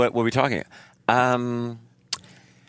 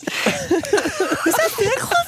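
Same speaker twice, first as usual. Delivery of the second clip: surprised door-to-door salesman; high, slow salesman. Pretend.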